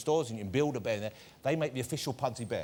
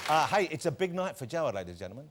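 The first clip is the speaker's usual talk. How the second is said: showman guest introductions; 6 semitones higher, 5 dB louder than usual. Acted. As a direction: announce each guest like a big-night showman. Host introduces guests, shouting names.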